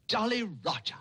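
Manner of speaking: bursts into a high giggling laugh; carried away with delight, laughing; emotional laugh. Acted. Tutor overjoyed at Jack's answer, high giggling laugh. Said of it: "I'm becoming emotional".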